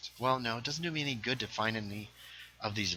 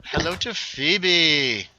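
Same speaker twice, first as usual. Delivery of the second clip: high, loud, drawn-out hello; hearty greeting; 6 semitones higher, 7 dB louder, much slower than usual. Real, not acted. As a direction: call a slow, big hello to her. Greeting someone who said hello.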